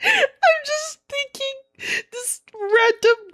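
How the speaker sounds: high and slow through laughter; laughing wind-up. Real, not acted. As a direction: giggle out the words. Laughing before the story goes on.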